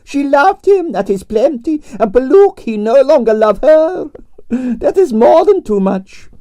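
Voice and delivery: grand Russian ballerina complaining about her night; Russian prima ballerina. Acted. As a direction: play the dramatic Russian diva. Arienskaya held voice.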